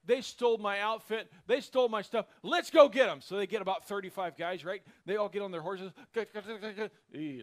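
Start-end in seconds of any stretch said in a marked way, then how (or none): 0.1-3.2 louder and higher, rousing the neighbors; building to a full shout on the last words; rallying shout. Retold or imitated. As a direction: call the neighbors to arms. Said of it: "gathers up the neighbors".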